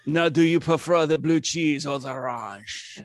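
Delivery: put-on comic French accent. French waiter asking dressing preference, French accent.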